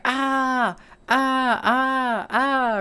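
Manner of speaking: high, repeated "ah" yelps; hammed-up freak-out, one wail after another; mock panicked cries. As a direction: imitate someone fleeing in panic. Mimics his panicked reaction to crying lockers.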